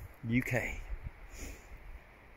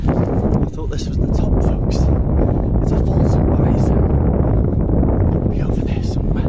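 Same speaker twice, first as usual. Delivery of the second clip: panting, breathy and louder after the climb; out of breath; 11 semitones lower, 8 dB louder than usual. Real, not acted. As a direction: gasp the words out between breaths. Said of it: "don't mind my deep breathing".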